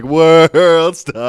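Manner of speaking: very loud, high drawn-out holler; whole store hollering in one voice; mock crowd yell. Voices whole store yelling World Star.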